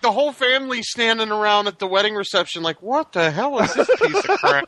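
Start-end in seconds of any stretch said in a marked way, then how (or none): 2.8-4.7 high, laughing mock outrage; mock baffled relative. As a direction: ape a baffled guest. Mocking family at reception.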